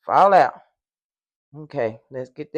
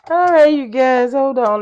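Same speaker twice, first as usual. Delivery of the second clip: raised voice over mixer; 7 semitones higher, 9 dB louder than usual. Real, not acted.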